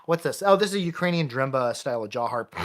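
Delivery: ordinary talk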